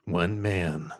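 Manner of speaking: slow, drawn-out movie trailer delivery; mock-dramatic announcer voice, very slow; radio announcer. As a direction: intone it like a radio promo. Radio voice joke after being told.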